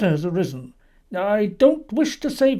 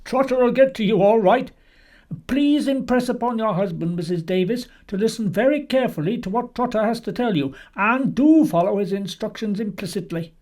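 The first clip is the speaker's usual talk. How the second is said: higher, assured police superintendent on the phone; unbroken confidence; 7 semitones higher, 5 dB louder than usual. A narrator's reading. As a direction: press on firmly, brooking no objection. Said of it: "no break in the confidence of the voice".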